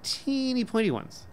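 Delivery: a small girl explaining, lightly played; lightly voiced little girl. Daughter telling father about the swallowed shell, childlike. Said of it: "said Kitty".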